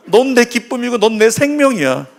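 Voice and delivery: high, emphatic affirmation; lifted, insistent words of love; Jesus. Acted. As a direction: declare it high to draw an amen. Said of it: "예수님 뭐라고요?".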